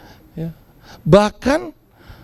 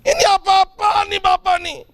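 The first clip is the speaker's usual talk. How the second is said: mock angry son; 8 semitones higher than usual. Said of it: "Alkitab bilang dia marah".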